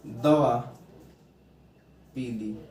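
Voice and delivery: दवा, a long pause, then a low, soft पी ली; pause between words. Shown as a demonstration. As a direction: break clearly after दवा before पी ली. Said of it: "इनके बीच में जो अल्प मौन".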